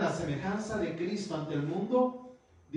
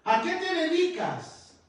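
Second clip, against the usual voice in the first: teacher's question; 10 semitones higher than usual. Retold or imitated. Teacher asks former pupil.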